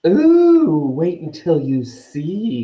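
high, loud, drawn-out glee; slow, gleeful ghost girl. A narrator's reading. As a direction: gloat with a long high swoop on the first word. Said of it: "looking so happy".

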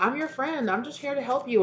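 high, sugary mock reassurance; fake-friendly. Mimics a falsely helpful friend.

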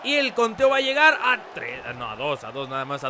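rising play-by-play call; wrestling commentator. Acted. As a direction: call the pin like ringside. Live play-by-play of wrestling match.

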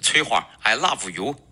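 put-on Chinese-accented love as "la-fu", rising at the end; Chinese learner's confession. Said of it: "有些同学向这个女生表白的时候是这样说的".

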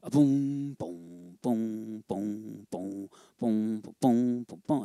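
low sung boom boom imitating a double bass; walking bass hum. Shown as a demonstration. Teacher humming the bass line for drum students, low legato booms. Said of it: "nice legato sound".

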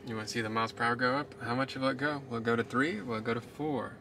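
ordinary talk

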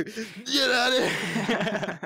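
slow, stretched, laughing through it; drawn-out laughing. Real, not acted. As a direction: drag it out while cracking up. Repeated, stretched while laughing.

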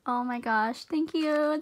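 normal talking voice

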